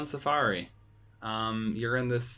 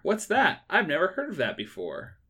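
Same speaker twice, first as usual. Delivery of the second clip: mock surprise; 7 semitones higher, 3 dB louder than usual. Feigned ignorance of famous game.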